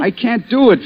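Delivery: high, pleading protest; desperate. Acted. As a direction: beg her not to, voice jumping up.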